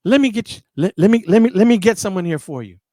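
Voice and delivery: loud, high, stumbling hold-on; busy manager. Retold or imitated. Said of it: "And he's like".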